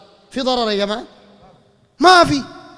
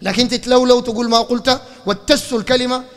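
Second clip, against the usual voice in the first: ordinary talk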